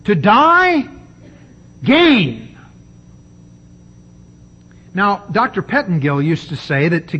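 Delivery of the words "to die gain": "To die" lifted higher and louder, "gain" driven down firmly; emphatic proclamation. Shown as a demonstration. Preacher proclaiming the verse to his listeners, emphatic. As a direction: call "To die, gain" out with force. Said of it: "put the emphasis on the right syllable".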